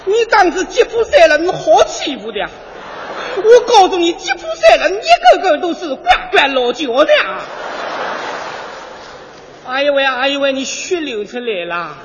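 put-on Yesenia dubbed loud in a Yangzhou accent. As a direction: scold him in Yangzhou dialect. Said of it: "我就是扬州的叶塞尼亚".

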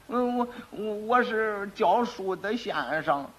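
soft, very slow, halting answer; stammer, then stretch out the false claim; nervous coached lie. Acted. Quack nervously recites coached lie.

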